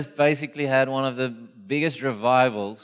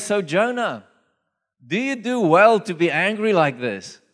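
amused God; 6 semitones higher, 3 dB louder than usual. Acted. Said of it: "I find it funny".

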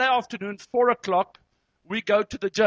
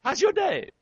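high-pitched, put-on friendly greeting; fake cheerfulness; 11 semitones higher than usual. Counselor greeting a couple while fuming inside, exaggerated fake cheer. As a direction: overdo the pleasant welcome. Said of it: "you can fake those relationships".

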